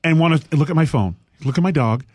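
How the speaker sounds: ordinary delivery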